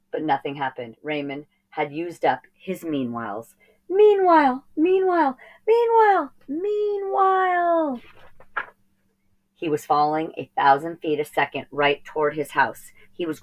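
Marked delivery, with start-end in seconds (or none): 3.9-8.0 high, repeated pleading, fading; desperate pleading. Acted. Boy whose magic word failed pleads high, then fades. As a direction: beg the word to work, ending slow.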